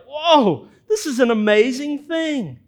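high, loud, wide-eyed astonishment; the healed man marvelling at the Pharisees, in loud, high amazement; amazed. Retold or imitated. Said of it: "he's like".

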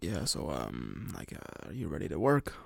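a lightly voiced burly boss; boss's voice. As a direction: drop low and take your time like the big boss. Big Australian boss voiced.

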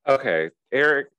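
ordinary delivery

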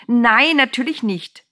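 high, loud "Nein", drawn out; loud, scandalised denial; indignant interjection. A narrator's reading. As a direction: burst in with emphatic protest. Woman interrupts angel with outcry.

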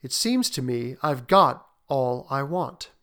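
slow, pondering contentment; slowly. Acted. Father deciding he has all he wants, slowly. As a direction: pause and ponder between phrases. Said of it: "he said slowly".

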